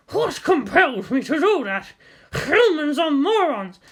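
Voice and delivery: high, slow, indignant beast voice; an outraged donkey berating its rider; horse voice. A narrator's reading. Fallen rider's donkey complains in an indignant horse voice. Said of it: "I hope you enjoy my horse voice".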